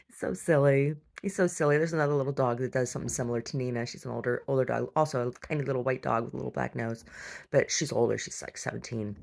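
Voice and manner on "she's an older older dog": ordinary talk, nothing marked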